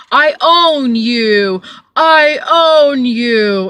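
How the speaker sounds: loud, slow, drawn-out snooty act; mock pretentious role-play. Driver mimicking passenger playing a stingy rich rider, mock pretentious. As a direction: imitate a haughty payer, stretching every phrase.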